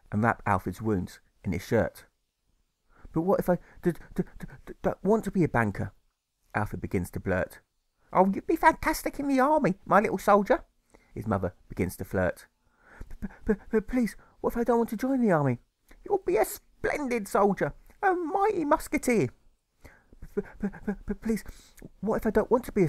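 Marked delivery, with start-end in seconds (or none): 3.1-5.9 put-on stammering boy. Alfred stammers in story. 8.1-10.6 mother's voice. Acted. 13.2-15.6 put-on stammering boy. Alfred pleads stammering. 16.0-19.3 mother's voice. Acted.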